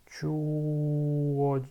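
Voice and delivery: 추워 stretched out slowly; slow careful Korean drill; slow syllable drill. Shown as a demonstration. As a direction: draw out 추워 syllable by syllable. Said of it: "So this 추워질".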